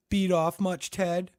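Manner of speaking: high mocking taunt; vicious sneer. Harmon taunting Ted, high and mocking. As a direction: taunt him with a smile. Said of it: "smiling viciously".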